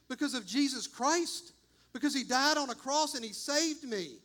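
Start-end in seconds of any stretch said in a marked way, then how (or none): none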